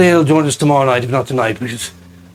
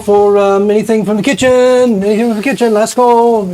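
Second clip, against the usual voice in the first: calling out; 7 semitones higher than usual. Acted. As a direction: call it across the whole bar. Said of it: "you hear Regis call out".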